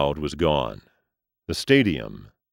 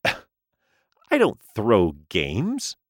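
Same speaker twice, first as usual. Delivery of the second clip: angry; 4 semitones higher, much slower than usual. Acted. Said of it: "coughed in surprise and anger".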